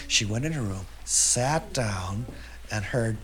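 ordinary delivery, nothing marked

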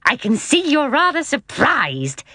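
slow, high-pitched uncle voice; amused, drawn-out observation; jolly uncle. A narrator's reading. Uncle to astonished children, jolly.

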